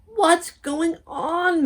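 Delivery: high, slow shout; shout over noise. Acted. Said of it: "shouted over and above all the noise".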